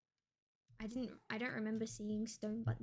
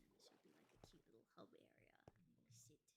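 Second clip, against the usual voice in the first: hushed, breathy aside about the tiny hub; whisper; 10 semitones lower, 30 dB quieter, much faster than usual. Real, not acted. Whispering to co-player while imagining being tiny. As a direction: whisper it like a secret to yourself.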